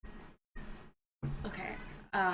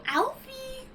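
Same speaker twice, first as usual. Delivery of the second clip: much higher pet-calling shout; calling for Alfie. Retold or imitated. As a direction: call him like a dog. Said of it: "what compelled me to go".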